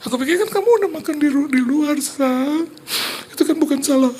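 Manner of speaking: loud, very high mock wailing; imitated crying. Wife cries that it is not her fault, mock wailing. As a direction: wail the excuse like a sobbing wife.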